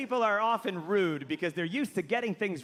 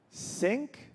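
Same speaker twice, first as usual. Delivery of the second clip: higher, hesitant question; tentative suggestion; 4 semitones higher than usual. Acted. Writer trying a second rhyme, cautious. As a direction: float it up cautiously.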